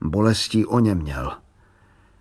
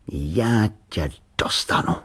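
slow, menacing whisper; dangerous voice; slower than usual. A narrator's reading. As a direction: whisper it slowly as a threat. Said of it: "řekl nebezpečným hlasem". (výhrůžný šepot)

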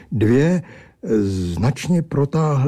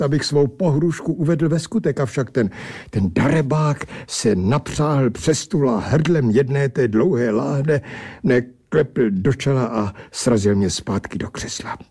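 usual speaking voice

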